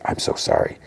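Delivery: hushed, whispered apology; soft apology. Retold or imitated. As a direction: say it very softly, almost voiceless. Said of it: "And softly, Matt's like".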